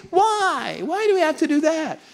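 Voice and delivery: high mock whine; whiny soldiers. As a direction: question the order like whining troops. Soldiers questioning a general.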